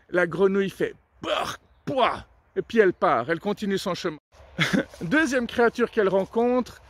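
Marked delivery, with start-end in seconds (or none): 1.2-2.3 high, disgusted yuck. Acted. Frog grossed out by the anteater's meal, high yuck. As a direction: spit out the thought of ants.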